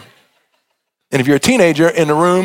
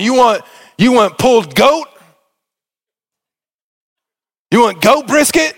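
mocking, raised pitch, half shouted; mock offer; 5 semitones higher than usual. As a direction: toss the offer back sarcastically. Mocking the father's feast.

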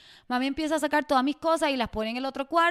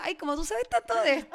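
doting mom voice; 5 semitones higher than usual. Pretend. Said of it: "en la punta de la lengua te decía".

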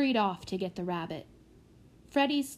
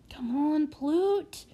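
tense, higher-pitched urging; muttered through clenched teeth; 6 semitones higher, much slower than usual. A narrator's reading. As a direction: urge him on through gritted teeth. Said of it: "he muttered through clenched teeth".

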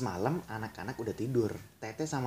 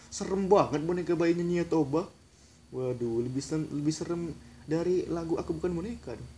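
usual speaking voice

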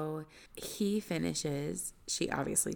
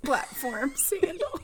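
laughing; 8 semitones higher, 5 dB louder, slower than usual. Real, not acted. Laughing comment.

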